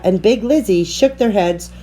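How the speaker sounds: ordinary delivery, nothing marked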